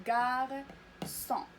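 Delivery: "غارسون" very slow, in two held syllables; model pronunciation. Shown as a demonstration. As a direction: stretch gar, then son with a clear s in "غارسون". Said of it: "إذا غادي نقراوها". (نطق "غارسون" ببطء بمقطعين ممدودين)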